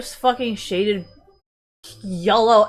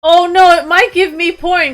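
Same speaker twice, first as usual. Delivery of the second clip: much louder, higher alarm; mock panic; 7 semitones higher, 12 dB louder than usual. Real, not acted. Streamer jokingly panicking about porn, loud mock alarm. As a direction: shriek it in fake alarm.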